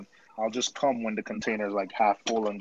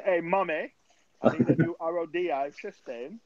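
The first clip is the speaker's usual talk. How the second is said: higher-pitched mock-posh British, breaking into a laugh; posh British accent; 6 semitones higher than usual. Joking about phoning the queen, mock-posh British accent. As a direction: put on a posh British voice, teasing. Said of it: "That's a posh British accent".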